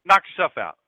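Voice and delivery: loud, curt brush-off; rude dismissal. Acted. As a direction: throw it at her loudly, not caring. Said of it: "She never talked to anybody like that".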